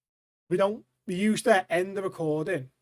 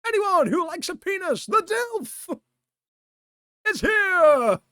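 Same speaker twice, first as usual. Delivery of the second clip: high, shouted, drawn-out proclamation; mock announcer; 11 semitones higher, 6 dB louder, slower than usual. Barista proclaiming the Dilf's arrival to everyone, shouted announcement. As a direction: build to a huge stretched finish.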